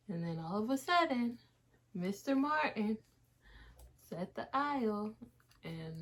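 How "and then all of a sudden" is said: usual speaking voice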